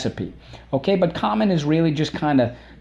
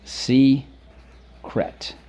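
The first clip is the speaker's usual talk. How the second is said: slow model; 2 semitones higher than usual. Shown as a demonstration. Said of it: "What's the word".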